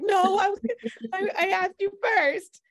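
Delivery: loud, very high-pitched objection; retold indignant protest. Wife telling husband she asked first, indignant.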